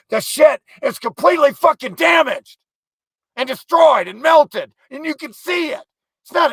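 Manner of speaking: high, loud ranting yell, slowing down; angry shout. Real, not acted. Man ranting at a meme about a temple, furious high shout. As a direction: explode at the stupid meme.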